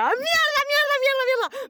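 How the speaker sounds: high, frantic repeated swearing; excited freak-out. Acted. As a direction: lose it like a fan whose name was called. Fan freaking out, name called.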